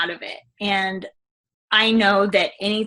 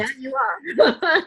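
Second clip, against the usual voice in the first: high, slow, strained yes, voice catching; choked-up agreement; 10 semitones higher, slower than usual. Real, not acted. Agreeing with her coach, choked up and high. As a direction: fight back the tears on it.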